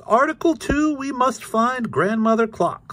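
very high, loud proclaiming cat; Krazy Kat. A narrator's reading. Krazy proposes articles to Ignatz, high proclaiming voice. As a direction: announce it grandly way up high.

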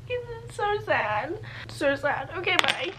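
high, tearful wail kept soft; real crying. Real, not acted. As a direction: break into tears as you speak, pitch climbing. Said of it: "I keep crying".

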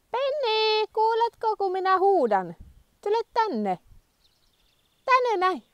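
loud, high drawn-out call; high loud call, growing impatient; calling the dog. Real, not acted. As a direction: call the dog again and again from afar. Repeatedly calling a straying dog.

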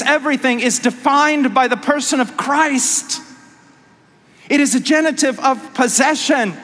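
fervent pulpit crescendo; preaching at full force. Acted. Sustained high-intensity preaching.

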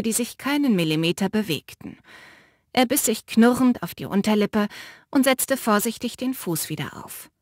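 plain reading voice

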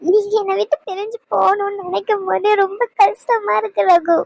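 tearful, voice breaking; crying. Acted. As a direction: plead through tears as he leaves. Wife pleads with leaving husband. (அழுதுகொண்டே)

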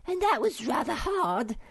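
very high-pitched small-creature voice; little creature Sniff. Acted. Sniff makes excuses for eating it, squeaky high. Sniff owns up about cake.

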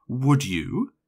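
ordinary delivery

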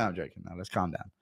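ordinary delivery

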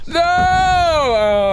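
loud, high, drawn-out wail; anguished shout. Real, not acted. Gamer who just ran out of bullets, anguished shout. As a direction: howl it out and let it sink.

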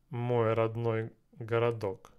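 ordinary talk

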